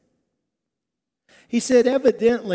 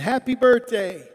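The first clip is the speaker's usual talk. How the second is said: raised birthday cheer; mock yell; 4 semitones higher, 5 dB louder than usual. Emcee rehearsing the party greeting, mild mock yell. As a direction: call it out higher and a bit louder. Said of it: "we're gonna yell".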